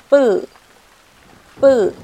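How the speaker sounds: bu，bu said tense and loud, like a falling fourth tone; tense stressed demo. Shown as a demonstration. Teacher showing the tense consonant, loud and forceful. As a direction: punch bu hard, tight and loud. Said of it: "发音呢是重读就可以了".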